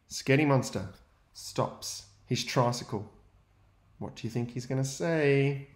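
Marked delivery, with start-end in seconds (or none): 0.1-3.1 put-on lively read-aloud for young children; story narration. A narrator's reading. Teacher reading to his class, lively storytelling. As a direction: read the picture book to the class with energy.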